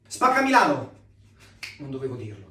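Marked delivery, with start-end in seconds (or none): 0.1-1.0 shouting. Acted. Blurted out joke.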